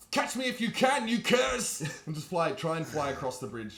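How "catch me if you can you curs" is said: high, loud yell down at enemies; taunting yell. Acted. Flying angel baiting the guards below, taunting yell. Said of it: "I'm gonna yell down at them".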